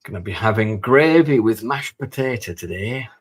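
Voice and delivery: loud, put-on northern English accent. Gamer joking to himself about gravy and mash, hammed-up northern accent. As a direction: play up a wandering northern accent. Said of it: "warrants a northern accent of some kind".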